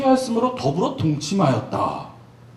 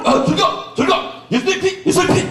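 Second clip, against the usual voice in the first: desperate mock exorcism yelling "예수의 피"; frantic rebuking shout; 8 semitones higher than usual. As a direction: shout the blood of Jesus at it. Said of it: "막 이러고 막 이러고 온갖 짓".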